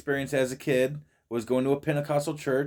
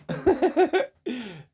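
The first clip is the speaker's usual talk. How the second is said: laughing; 13 semitones higher than usual. Real, not acted.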